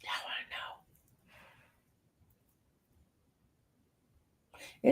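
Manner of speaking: teasing hushed whisper. Real, not acted. Whispering to nosy viewers, teasing. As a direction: tease the chat in a whisper.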